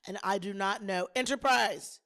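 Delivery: ordinary talk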